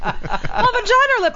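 mock high whine. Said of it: "She's like".

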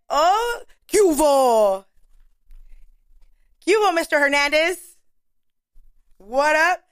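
loud, happy call-out to a friend; excited greeting. Real, not acted. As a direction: shout his name across the room. Greeting tattoo artist on arrival.

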